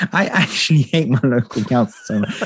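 real exasperated laugh breaking into the words; helpless, angry laughter while talking; laughing from anger. Real, not acted. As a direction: laugh it out in sheer angry disbelief. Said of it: "I'm laughing from anger".

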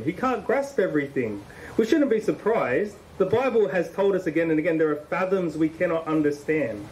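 ordinary talk

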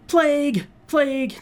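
high, mock panicked shopper. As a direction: squeal it like a frightened bystander. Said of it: "And they're like".

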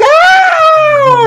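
high, loud mock scream of pain. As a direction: shriek as if hurt, hammed up. Girl's foot hits trash can.

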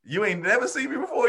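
high disbelieving question; can't believe what you hear; incredulous question. Retold or imitated. Confronting the joke thief, high incredulous question. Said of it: "and I'm like".

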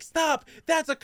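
squeaky imitation of a whistle-blowing kid; mock Mokuba yelling stop. Friend imitates Mokuba stopping him, high mock voice. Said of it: "and he'll be like".

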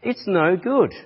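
preaching rebuke. Acted. Preacher listing the church's failings to the congregation, building rebuke. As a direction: hammer each failing home from the pulpit.

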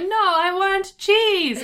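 high, loud, drawn-out whine; slow mock tantrum demand; imitated toddler. Toddler rejecting the cereal they asked for, loud high whine. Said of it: "They're like".

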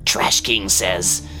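slow, strange raccoon creature voice. Acted. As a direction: speak slowly as a wild critter.

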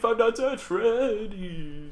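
very slow, drawn-out dramatic chant. Retold or imitated. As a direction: stretch every word like a theatrical rock singer. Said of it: "And he goes".

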